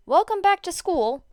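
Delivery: bright, loud, upbeat welcome; cheery announcement. A narrator's reading. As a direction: announce it with a big grin. Cheerful chapter summary opening.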